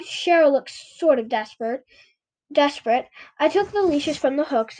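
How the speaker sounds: usual speaking voice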